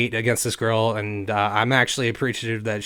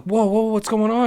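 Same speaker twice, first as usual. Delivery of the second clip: high, louder startled questioning; mock-alarmed, raised pitch; startled concern; 10 semitones higher, 4 dB louder than usual. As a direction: re-enact an alarmed bystander rushing up. Bystander's alarm acted out.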